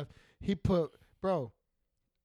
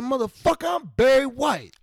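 loud, higher-pitched brash boast; 6 semitones higher, 10 dB louder than usual. Retold or imitated. Retelling Barry's interview, loud mock boast. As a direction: throw it out loud and proud. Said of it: "He said".

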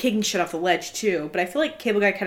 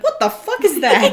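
high, loud burst of disbelief with a laugh; shook; 11 semitones higher, 6 dB louder than usual. Retold or imitated. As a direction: blurt it out in mock shock. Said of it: "Did you notice how shook he looks?".

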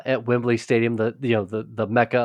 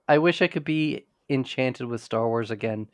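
usual speaking voice

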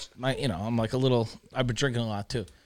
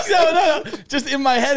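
loud, high protest through laughter; cracking up while insisting; laughing denial; 13 semitones higher, 8 dB louder than usual. Real, not acted. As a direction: laugh off the teasing, voice raised. Teased by co-host, laughs denying.

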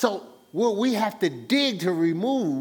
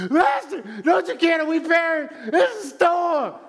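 much higher, louder, frantic plea; panicked cry; 10 semitones higher, 5 dB louder than usual. Retold or imitated. Disciples crying to Jesus in the storm, panicked. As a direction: cry out to the master in terror. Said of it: "they came crying to Jesus".

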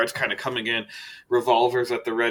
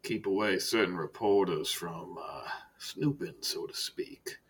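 low, soft, measured director voice with long pauses; Director Silas, understated menace; 4 semitones lower, 10 dB quieter, slower than usual. Acted. Director turns to business with Archibald, quiet and measured. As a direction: speak quietly and let each phrase hang.

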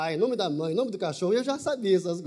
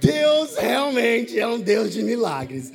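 preaching; 6 dB louder than usual. Acted. Telling the church God performs miracles, louder preaching. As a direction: proclaim it to the congregation.

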